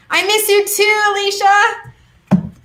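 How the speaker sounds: high, drawn-out fond answer; affectionate reply. Real, not acted. Reader answering a viewer's chat, fond and drawn out. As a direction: coo it back to a friend.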